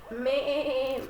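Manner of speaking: drawn-out, wobbling baa; playful sheep imitation; sheep bleat.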